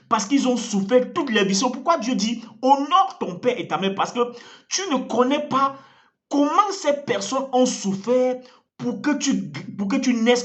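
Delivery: ordinary delivery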